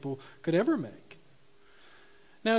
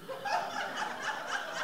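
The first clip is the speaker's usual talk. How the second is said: a high, airy burst of laughter; real high laugh; 8 dB quieter than usual. Real, not acted. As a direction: laugh out at the punchline. Laughing at own joke.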